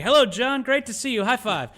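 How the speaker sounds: very high, mock-chummy greeting; hammed-up Pocahontas. Pocahontas greeting John in fluent English, hammed-up and high. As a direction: greet him in a silly high put-on voice.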